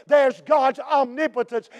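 usual speaking voice

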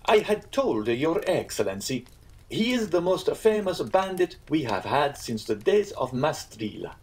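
lifted voice, stressing the bandit's fame; earnest Italian innkeeper. Acted. Innkeeper informing guests about the famous bandit, earnest.

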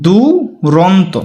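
দুরন্ত very slowly, "duu... ron-to"; pronunciation demo. Shown as a demonstration. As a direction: stretch the du of দুরন্ত, then ron-to. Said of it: "আমরা উচ্চারণ করে দেখো". (দুরন্ত ভেঙে ধীরে উচ্চারণ)

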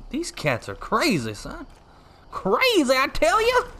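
playing the backwoods local; Oakdale yokel voice. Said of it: "Why am I still talking like this?".

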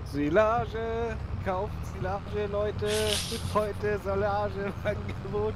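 market crier. Pretend. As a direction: cry out the silage deal like a market stall. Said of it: "Du sollst den Marktschreier spielen".

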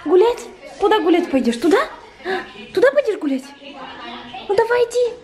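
high sing-song voice for the puppy; talking to a dog. Real, not acted. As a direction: coax the puppy excitedly. (сюсюкая с собакой)

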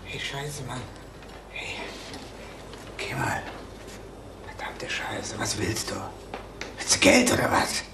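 verängstigt geflüstert; leise, gehetzt, um Zeit ringend; whispered fury. Acted. Bedroht, flüstert panisch. (panisches Flüstern)

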